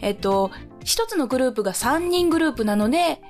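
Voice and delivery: ordinary talk, nothing marked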